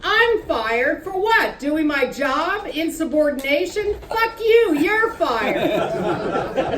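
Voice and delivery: slow, incredulous then exploding; outraged shouting back; indignant rage. Acted. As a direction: build from disbelief to a high curse. Acted quarrel over the flag.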